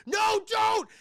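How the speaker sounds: high, loud panicked cry; panicked plea. Acted. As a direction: yelp it like you're about to be hit. Orderly begs Batman.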